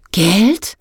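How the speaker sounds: loud astonished echo; loud repeat. A narrator's reading. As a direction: blurt it out, surprised. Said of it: "wiederholte Sansibar laut". (laut und verblüfft)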